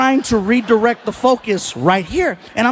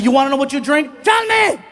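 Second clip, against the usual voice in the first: retold pissed-off; 4 semitones higher, faster than usual. Comic finally snapping at the heckler, acted angry challenge. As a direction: challenge rising to a high shout. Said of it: "Fluffy's pissed".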